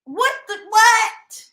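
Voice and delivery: high, loud disbelieving what; stunned what. Real, not acted. Vlogger reacting to the mother's rant, stunned disbelief. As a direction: blurt it out in shock.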